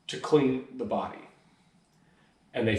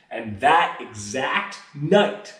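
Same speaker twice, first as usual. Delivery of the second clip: ordinary talk, nothing marked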